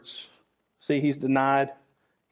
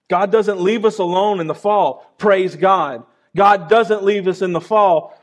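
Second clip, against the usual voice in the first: louder, higher preaching build; rising preaching fervor; 5 semitones higher, 6 dB louder than usual. Acted. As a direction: lift the congregation up. Preaching climax on God's presence.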